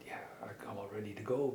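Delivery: hushed whisper, barely voiced. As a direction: whisper it like a secret. Character's line in a story.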